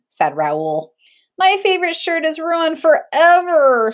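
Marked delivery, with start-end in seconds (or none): 1.4-3.9 put-on upset child. A narrator's reading. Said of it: "said Benjamin".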